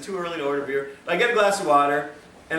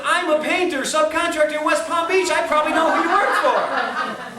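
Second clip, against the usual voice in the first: higher, louder chummy boast with a laugh; lightly voiced excited contractor; eager painter; 10 semitones higher, 4 dB louder than usual. Painter tells the narrator he knows his bosses, excited. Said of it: "He said".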